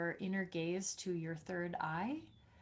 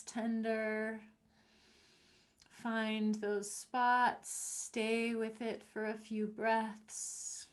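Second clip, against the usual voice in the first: guided meditation. Acted. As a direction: ease them gently through each step. Guides a self-massage.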